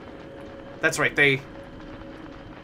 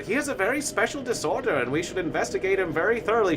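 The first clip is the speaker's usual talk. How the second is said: higher-pitched mock doctor documenting a case; earnest doctor; 5 semitones higher than usual. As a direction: present the case like a specialist, imitated. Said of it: "even the doctor who was, like, documenting the disorder".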